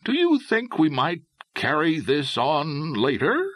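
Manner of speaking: ordinary delivery, nothing marked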